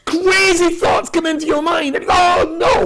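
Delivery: slow, very high, loud wail; over-the-top frantic yelling; hammed-up panicked shout. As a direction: cry it out as if losing control. Acts out wild intrusive thoughts.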